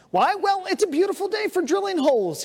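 high, singsong cheerful answer; blithely unconcerned, pitched high; blithe fisherman. Acted. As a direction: answer brightly as if it were perfectly normal. Fisherman blithely explains absurd drilling.

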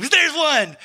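high, sudden excited cry; imitated excitement. Friend spots an antelope at last, imitated excitement. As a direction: play the friend spotting it, thrilled. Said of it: "he was so excited".

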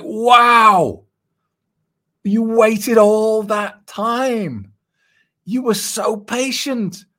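high, slow, amazed mock praise; shocked admiration. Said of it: "I was shocked".

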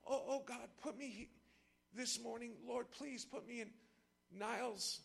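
hushed, halting mock prayer; softly plead, pausing between phrases. Preacher praying to be where he already is, mock-earnest hushed pleading. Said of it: "like me sitting here saying".

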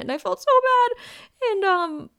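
high, loud, drawn-out wail of guilt; whiny lament. Real, not acted. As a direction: stretch it out like a guilty whine. Regret over letting friend down.

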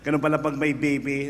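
ordinary talk

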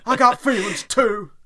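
high, loud, slow Southern wrestler line; Big Boss Man. Retold or imitated. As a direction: belt it out like a wrestling promo. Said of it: "Big Boss Man".

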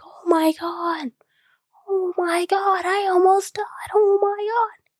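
high, hushed panicked whisper; a retold terrified gasp of oh my God, whispered high; hysterical panic. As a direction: mimic the little cousin freaking out under his breath. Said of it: "he's screaming hysterically".

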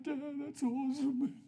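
high, drawn-out mock crying; mock blubbering. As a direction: pretend to wail it to dad. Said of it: "I wasn't crying. I was like".

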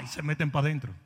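ordinary talk

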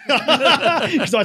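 slow, high and loud through laughter; laughing. Real, not acted. Laughing at how scared he was of Crusher. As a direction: stretch it out while cracking up.

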